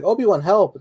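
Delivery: mock Jedi cry for help. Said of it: "He's the Jedi who's like".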